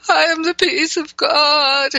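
tearful, high, breaking voice; crying through tears. Retold or imitated. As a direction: speak through tears while laughing. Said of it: "I'd be saying".

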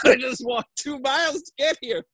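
high-pitched, laughing plea of exasperation; retold excuse squeezed out high through a laugh; exasperated protest. Retelling his excuse to Hayes, laughing.

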